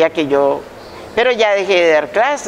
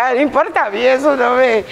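dismissive high shrug-off; 7 semitones higher than usual. Retold or imitated. Shrugging off a teasing nickname.

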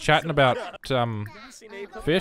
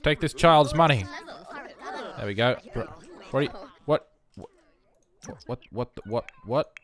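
usual speaking voice

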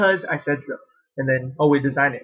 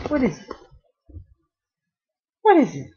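high sing-song dog talk; 9 semitones higher, faster than usual. Real, not acted. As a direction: ask the puppy playfully, way up high. Baby talk to her dog.

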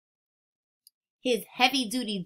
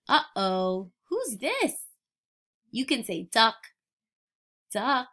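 ordinary delivery

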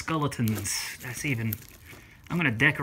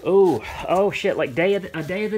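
surprised; 6 semitones higher, 7 dB louder than usual. Real, not acted. Surprise at a gift.

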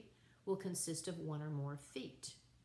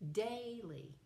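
daily, DAI hit high and stretched, falling away; stress on first syllable; 5 semitones higher than usual. Shown as a demonstration. Teacher showing students trochaic feet, daily stressed high on the first syllable. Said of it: "the accent is on the first syllable".

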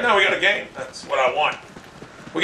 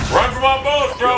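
raised, higher-pitched exasperated repeat; exasperated call; 5 semitones higher than usual. Real, not acted. Gamer calling after a fleeing enemy, exasperated call.

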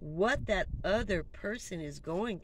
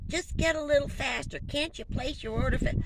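retold scream; 9 semitones higher than usual. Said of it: "someone's screaming".

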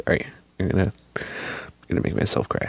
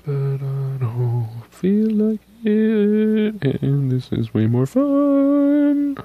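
high silly voice; 12 semitones higher, 5 dB louder, slower than usual. Pretend. Playful exaggerated voice.

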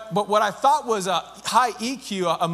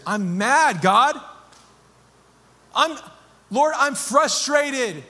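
raw prayer; 6 semitones higher than usual. Acted. Believer venting anger to God in prayer, raised and strained. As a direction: pour out frustration to God.

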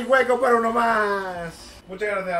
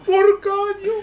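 surprised cry; 12 semitones higher, much slower than usual. Real, not acted. Surprised at a nutmeg.

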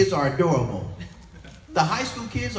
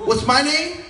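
mock demand; 7 semitones higher, 6 dB louder than usual. Worker demands caller's name.

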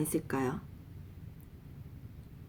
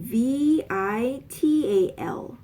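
spelled out slowly; 2 semitones higher, much slower than usual. Shown as a demonstration. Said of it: "spelling out the word".

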